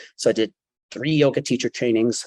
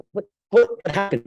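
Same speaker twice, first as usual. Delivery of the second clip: high-pitched stammered question, imitating a stunned onlooker; retold startled question; 8 semitones higher than usual. Old lady to the man squeezing past, startled. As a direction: ask it high, taken aback. Startled seatmate stares and asks.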